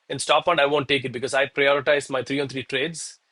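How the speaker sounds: ordinary talk, nothing marked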